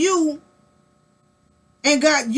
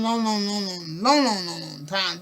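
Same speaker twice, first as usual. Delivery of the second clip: fading repetition; 4 semitones lower, 5 dB quieter than usual. Acted. Drawn-out repetition fading away.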